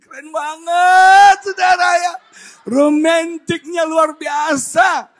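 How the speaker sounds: loud, high, long-stretched gushing; gushing excitement. Real, not acted. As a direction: shout the delight, drawing out the words. Gushes about Nami Island. (berseru kagum, keras, tinggi, diulur)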